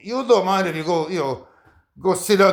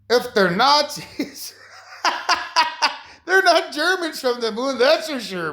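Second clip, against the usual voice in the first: much higher, slow words broken by laughter; laughing; 8 semitones higher, slower than usual. Real, not acted. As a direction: crack up while making the point. Laughing at his own point.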